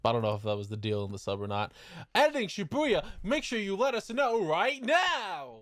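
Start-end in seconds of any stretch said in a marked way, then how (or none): 2.1-5.6 high, theatrical singsong summons; calling out. Pretend. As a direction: hail the absent editor grandly. Calls out to future editor self.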